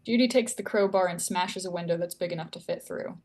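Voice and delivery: normal talking voice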